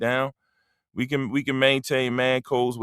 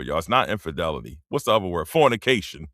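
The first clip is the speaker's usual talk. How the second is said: ordinary talk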